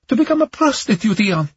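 very high, fast, upset woman; verge of tears. Acted. Camellia explains her debt to Ion, near tears. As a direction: push it out high, close to tears. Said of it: "She sounded like she was on the verge of tears".

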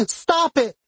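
very high, desperate yell; crying out. A narrator's reading. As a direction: cry out for it to end. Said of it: "she cried".

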